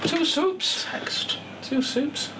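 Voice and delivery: high doddery waitress voice; Victoria Wood waitress. Acted. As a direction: play the slow old waitress. Victoria Wood sketch impression.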